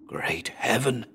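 breathless awed whisper, no voice. A narrator's reading. Narrator sighting the giant lizard, whispering in awe. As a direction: whisper it so the beast won't hear. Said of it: "I whispered".

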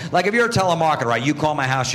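normal talking voice

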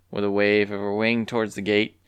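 usual speaking voice

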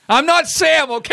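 high mock protest. Real, not acted. Joking protest to co-host.

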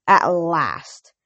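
a touch of gloating satisfaction; satisfied. A narrator's reading. Hades greeting his long-awaited captive, satisfied. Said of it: "he said with satisfaction".

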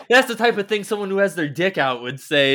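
high, loud retold accusation; mock suspicion.

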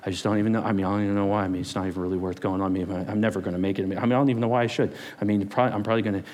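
low, fast, mumbled gloom; discouraged voice. Acted. Fear speaking discouragement, low and defeated. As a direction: sink into hopeless muttering. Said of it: "fear speaks discouragement".

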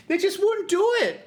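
very high, outraged disbelief; comic exasperation. Real, not acted. Comedian to host after the failed plea, exasperated outburst. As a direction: let it burst out high and exasperated. Climax of the chips rant.

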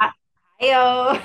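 high, loud laughing Dakota greeting; playful North Dakota accent hello. Acted. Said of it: "We gotta have our Dakota accent here".

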